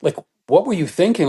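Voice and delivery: baffled, rising "whoa, dude, what was that"; half-laughing disbelief at a friend's outburst; startled onlooker. Retold or imitated. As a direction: ask like you can't believe what you just saw. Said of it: "someone looks at me and goes".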